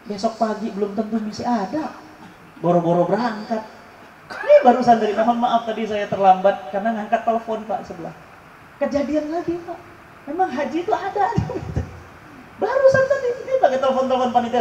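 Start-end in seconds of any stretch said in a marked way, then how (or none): none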